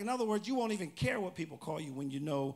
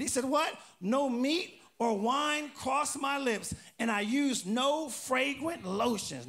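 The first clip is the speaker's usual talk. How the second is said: loud, raised pulpit voice; scripture reading; 5 semitones higher, 5 dB louder than usual. Acted. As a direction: proclaim each phrase for the congregation to follow. Reads scripture with prompts.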